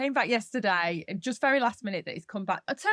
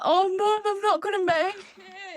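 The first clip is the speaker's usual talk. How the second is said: whiny daughter; 9 semitones higher than usual. Retold or imitated. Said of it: "She's like".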